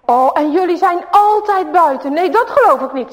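high, loud indignant bully; outraged that they back out; a hammed-up angry kid; bully boy indignant. A narrator's reading. Huip accuses the brothers.

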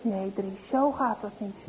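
gentle smile. Acted. Mother gently correcting Dries. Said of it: "Moeder moet een beetje glimlachen".

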